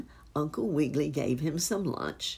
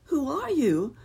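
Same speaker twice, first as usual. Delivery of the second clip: high, louder startled question; 11 semitones higher, 5 dB louder than usual. A narrator's reading. Rabbit to an unseen voice, startled question. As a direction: call out high to find out who it is.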